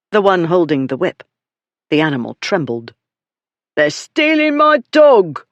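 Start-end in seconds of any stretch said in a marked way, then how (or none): 3.8-5.5 high, loud shout for help. Acted. Dog beater shouting to the crowd about thieves, high and loud. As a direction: yell to the whole inn yard. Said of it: "the dog beater shouted".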